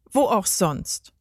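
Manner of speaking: ordinary delivery, nothing marked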